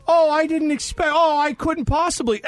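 high, hammed-up fake surprise; mock modesty. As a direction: feign being flattered and reluctant. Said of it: "You'd be like".

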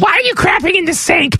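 a touch of shocked wife. Retold or imitated. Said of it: "Your wife would walk in and say".

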